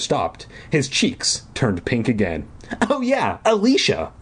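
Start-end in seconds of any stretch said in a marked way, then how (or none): 2.9-4.1 airy, offhand counselor; camp counselor voice. A narrator's reading. Buddy voiced, high.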